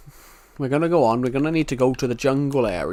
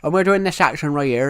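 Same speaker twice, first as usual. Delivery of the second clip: a louder, lightly thickened regional UK accent; thick UK accent; 4 dB louder than usual. Shown as a demonstration. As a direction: lean into his own UK accent, thicker. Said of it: "that is actually what my accent sounds like if it's actually really thick".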